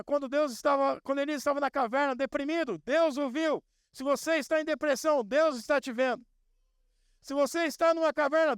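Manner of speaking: high, building fervent preaching; preaching at full force. Acted. Preaching climax, rising pitch.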